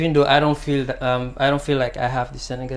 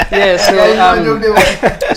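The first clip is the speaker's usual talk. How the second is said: slow, still laughing, louder; 7 semitones higher, 13 dB louder, much slower than usual. Real, not acted. Guest settling back to answer the host, still laughing.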